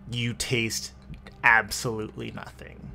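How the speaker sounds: ordinary delivery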